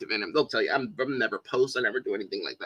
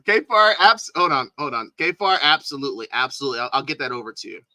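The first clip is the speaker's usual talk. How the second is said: usual speaking voice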